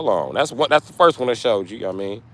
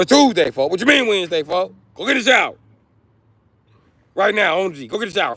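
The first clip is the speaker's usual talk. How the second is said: yelling; 8 semitones higher than usual. Acted. Yelling at a dirty man.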